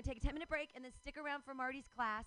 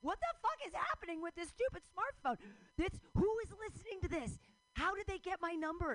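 higher, exasperated paranoid rant; mock freakout; 9 semitones higher, 3 dB louder than usual. Ranting about Bixby spying on her phone, mock panic. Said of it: "and I'm like".

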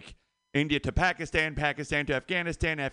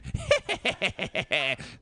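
higher, cackling "he he he"; anime sidekick laugh; 4 semitones higher than usual. Pretend. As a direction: cackle like an anime second-in-command. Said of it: "like the second to head guy".

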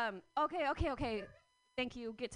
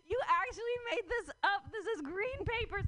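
higher, incredulous mock voice; mock disbelief; 9 semitones higher, 3 dB louder than usual. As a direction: laugh it off as nonsense, pitched up. Natives mock paper money.

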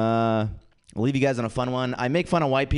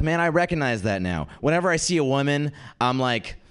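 normal talking voice

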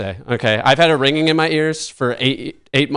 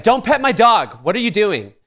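mock indignant, pitched higher; a hammed-up outraged owner, a bit higher; upset dog owner; 8 semitones higher than usual. Dog owner scolding a stranger for petting her dog, mock indignant. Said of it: "The owner was like".

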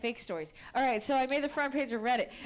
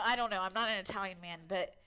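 ordinary talk

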